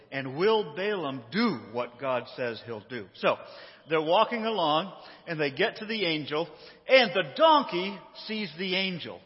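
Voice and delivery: usual speaking voice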